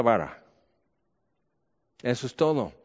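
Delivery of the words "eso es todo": ordinary talk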